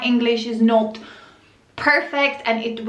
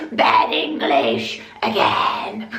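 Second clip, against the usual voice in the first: low, slow critic voice; hammed-up deep grumble; mock disapproval; 10 semitones lower, slower than usual. Reading out a hostile comment, mockingly.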